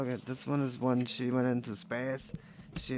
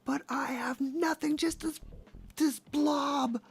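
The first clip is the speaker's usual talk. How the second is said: much higher, slow, half-whispered whine; plaintive mermaid voice; 14 semitones higher than usual. Retold or imitated. Voicing the boobless mermaid complaining about Michael. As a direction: complain up high with whispery despair. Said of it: "she's like".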